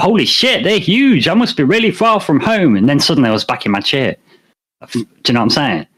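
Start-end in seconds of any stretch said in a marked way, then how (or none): 0.0-2.8 amazed. Retold or imitated. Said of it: "and I thought".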